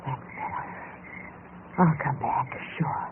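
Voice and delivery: voiceless, pleased whisper; satisfied whisper. Acted. Temptress whispering to the dazed married man, pleased. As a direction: breathe it out like a purr of victory. Seductress whispers after kiss.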